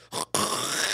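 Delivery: hawking American-style spit. Shown as a demonstration. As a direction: imitate a long hawk and spit. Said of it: "They spit down".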